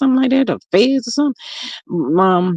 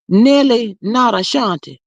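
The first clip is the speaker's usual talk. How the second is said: ordinary talk, nothing marked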